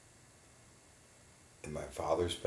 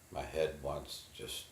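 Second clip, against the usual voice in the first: ordinary delivery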